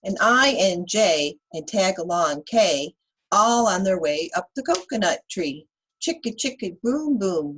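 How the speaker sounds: put-on lively read-aloud for kids; reading to children. Teacher reading Chicka Chicka Boom Boom to children, lively. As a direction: read the rhyme to the class with bounce.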